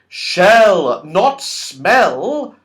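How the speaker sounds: impatient. A narrator's reading. Franny losing patience with the deaf man, loud and impatient. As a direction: stress "Shell" over "not smell" crossly. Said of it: "said Franny impatiently".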